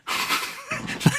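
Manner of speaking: a quick airy burst of laughter; breathy high laugh. Real, not acted. Laughing at his own scolded younger self, airy burst.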